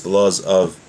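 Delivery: normal talking voice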